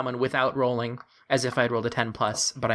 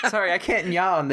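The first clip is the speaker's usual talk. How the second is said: laughing; 6 semitones higher than usual. Real, not acted. Laughing at the joke.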